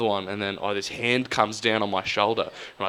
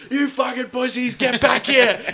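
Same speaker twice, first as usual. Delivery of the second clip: much higher, loud mock-furious yell; high yelling taunt; 6 dB louder, slower than usual. After the departing rugby boys, a high furious yell. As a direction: yell after them like a madman.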